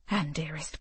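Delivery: hushed whisper. Acted. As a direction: whisper it aside. Hushing aside to daughter.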